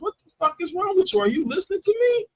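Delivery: high, indignant retold rant; exasperated. Customer asking if worker is listening, exasperated.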